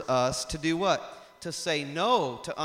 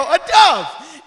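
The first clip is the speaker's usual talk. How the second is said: high, loud shocked cry of alarm; startled cry; 11 semitones higher, 17 dB louder than usual. Retold or imitated. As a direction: blurt it out in fright. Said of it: "I go".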